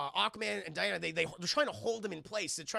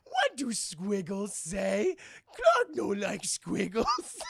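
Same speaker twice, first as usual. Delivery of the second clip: very slow, louder, dim caveman voice; head trauma Superman; 4 dB louder, much slower than usual. Acted. Staring at the monument, dim Superman slowly complains. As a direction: puzzle over the words like a brute. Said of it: "This is head trauma Superman".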